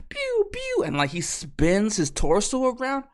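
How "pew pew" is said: very high-pitched "pew, pew"; imitated laser shots. Robot shooting at desert pirates, high laser noises. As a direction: fire off high toy blaster noises. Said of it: "he's just like".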